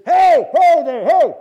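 huge high shout, drawn out; booming voice of God. Acted. Preacher mimics God calling out, booming shout. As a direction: holler from the heavens like a booming God. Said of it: "how does God talk to you?".

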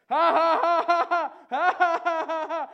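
very loud, high staged belly laughs; hysterical laughter. Acted. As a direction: build to uproarious, forced laughing fits. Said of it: "you got hysterical laughter".

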